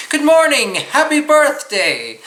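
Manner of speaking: high, loud, bouncing singsong greeting; lively sing-song greeting. Shown as a demonstration. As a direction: lift it up brightly like a cheerful hello. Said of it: "You would also say".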